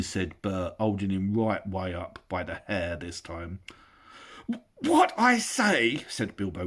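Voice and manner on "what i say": very high, slow gasped words; gasping hobbit. Acted. Bilbo dangling by his hair, gasping. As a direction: choke it out, held by the hair.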